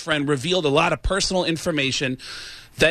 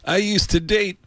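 [name] impression; 2 semitones higher than usual. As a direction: do a crooner reminiscing with little pauses. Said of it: "[name] impression".

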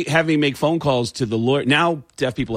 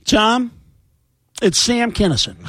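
higher, louder, imitating Sam Kinison; Sam Kinison impression; 9 semitones higher, 4 dB louder than usual. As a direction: announce yourself as the dead comic. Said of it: "And I said".

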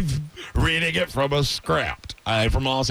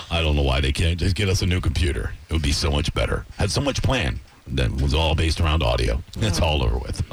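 much lower radio-host stepdad; 13 semitones lower than usual. Pretend. Stepdad Roger introducing himself like a radio host, low.

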